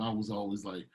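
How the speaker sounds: normal talking voice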